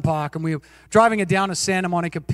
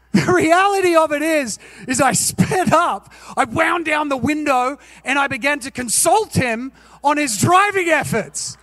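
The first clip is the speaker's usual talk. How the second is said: much higher and louder, worked up; heated retelling; 7 semitones higher, 3 dB louder than usual. Real, not acted. To the congregation, confessing the road rage, higher and louder. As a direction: tell it all fired up and loud. Admits real angry reaction.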